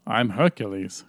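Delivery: dubbed Arnold voice. Retold or imitated. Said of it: "They dubbed over him".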